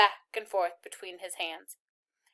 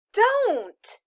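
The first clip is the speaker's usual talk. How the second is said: high, loud sharp protest; angry cry; 13 semitones higher, 6 dB louder than usual. A narrator's reading. Girl stopping the soldier's hands, angry cry. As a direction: burst out to stop him. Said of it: "she said angrily".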